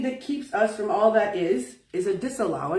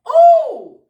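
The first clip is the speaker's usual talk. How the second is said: much higher, louder, tearful cry; crying out in emotion; 7 dB louder, slower than usual. Real, not acted. Speaker overcome telling listeners their soul knows, crying out. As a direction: let it break into an emotional cry.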